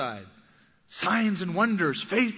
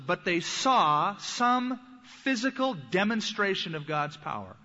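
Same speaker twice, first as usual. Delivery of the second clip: usual speaking voice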